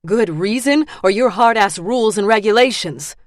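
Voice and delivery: loud, sharp challenge; angry retort. Acted. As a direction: throw it back at him. Furious retort.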